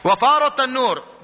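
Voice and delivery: very slow, loud, high recitation; ringing scriptural recital; Quran recitation. Acted. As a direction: draw the verse out forcefully.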